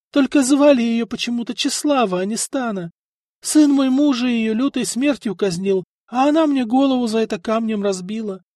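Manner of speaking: high, loud tearful pleading, lightly acted; tearful telling. A narrator's reading. The old woman tells the envoys her story, lightly tearful, high and loud. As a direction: pour it all out, a hint of weeping. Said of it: "разрыдалась милица".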